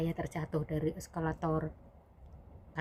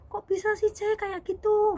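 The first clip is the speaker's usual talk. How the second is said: high, loud, slowing disbelief; shocked questioning; 6 dB louder than usual. Retold or imitated. Boss asking maid how she got hurt, shocked questioning. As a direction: imitate an aghast boss.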